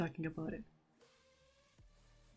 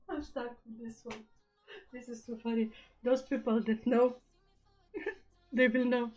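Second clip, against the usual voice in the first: giggly, pitched up, breaking into laughs; real giggling; 4 semitones higher than usual. Real, not acted. Streamer finds their own poem too funny, giggling. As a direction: talk between bursts of laughter. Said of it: "I shouldn't be laughing".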